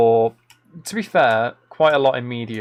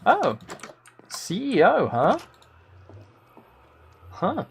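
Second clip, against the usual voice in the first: impressed; 7 semitones higher, slower than usual. Real, not acted. Impressed by viewer's CEO training.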